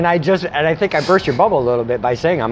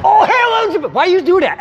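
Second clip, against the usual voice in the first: mock outrage; 13 semitones higher, 4 dB louder than usual. Mock triggered by name.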